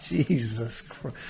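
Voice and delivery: low, soft, drawn-out dismay; hushed disbelief. Retold or imitated. Reacting to the onlookers' cruel shout, hushed disbelief. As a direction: say it slowly under your voice, appalled.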